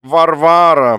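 high, loud, stretched-out call; theatrical high-pitched pleading; repeated plaintive call. A narrator's reading. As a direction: wail the name at his wife. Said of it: "повторил он".

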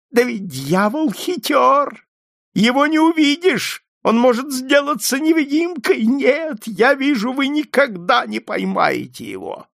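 put-on piercing, shouty, very high old man; shrill. A narrator's reading. Old man mocks hopes of catching devil, shrill. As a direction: crow it shrilly at the top of your range. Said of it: "засмеялся как-то пронзительно и крикливо".

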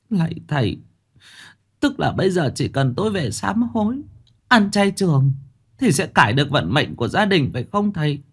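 put-on high pleading old woman, faintly tearful; bà cụ nước mắt. A narrator's reading. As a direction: ask with hope through mild tears. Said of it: "nước mắt ngắn dài". (giọng bà lão cao, hơi nghẹn)

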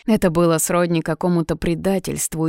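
usual speaking voice

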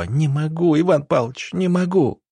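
high, desperate wail, held in volume; pained cry. A narrator's reading. Woman in labour crying out to her doctor, in pain. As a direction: plead in pain that it is too much. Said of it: "Марина закричала снова".

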